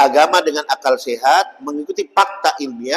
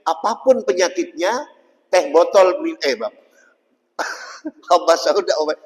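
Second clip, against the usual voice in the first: normal talking voice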